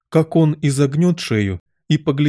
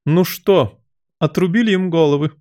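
high, raised royal voice; shouted question; 7 semitones higher, 3 dB louder than usual. A narrator's reading. Queen to her soldiers, shouting. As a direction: call out, demanding a report. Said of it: "крикнула королева".

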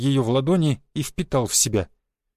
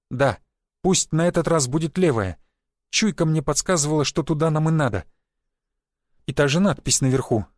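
plain reading voice

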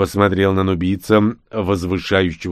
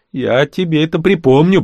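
higher, louder threat, rising on припомню; threatening; 7 semitones higher, 4 dB louder than usual. Acted. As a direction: warn him you'll get even. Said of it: "пригрозил я ему". (угрожающе, громко)

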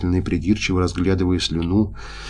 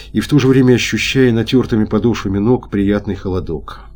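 plain reading voice

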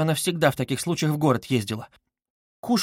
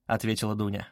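ordinary delivery, nothing marked

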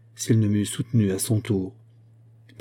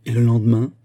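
louder, pressing question; can't wait to hear what came next; eager, moved question; 6 dB louder than usual. A narrator's reading. Stranger urging the narrator on, eager and moved. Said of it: "s'écria l'étranger, tout ému".

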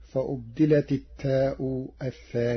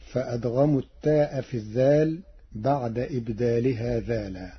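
ordinary talk, nothing marked

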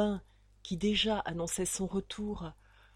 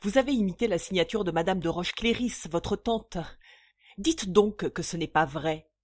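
loud, mocking accusation; unleashed brute sneering at the count; 6 dB louder than usual. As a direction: jeer at the forger. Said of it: "la brute déchaînée ricana".

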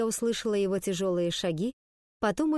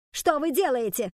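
higher, shocked teacher's question; shouted demand; 5 semitones higher, 3 dB louder than usual. A narrator's reading. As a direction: demand an explanation in a raised voice. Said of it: "снова окрикнула мисс Финч". (возмущённый окрик)

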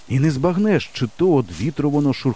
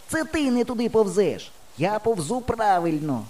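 shouting back; 9 semitones higher than usual. A narrator's reading. Friend blames the boy for crawling astray, shouting back. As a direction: argue back from far off. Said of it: "кричить він".

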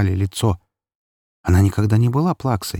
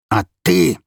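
high, raised, accusing shout; angry outburst; 9 semitones higher, 4 dB louder than usual. A narrator's reading. Father rounding on his daughter, angry shout. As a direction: turn the anger on a new target.

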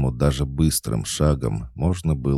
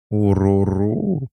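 cat-like purr; 9 semitones higher, 4 dB louder, slower than usual. Acted. Cat goddess purrs happily.

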